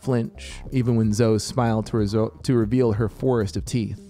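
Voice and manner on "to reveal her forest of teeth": ordinary delivery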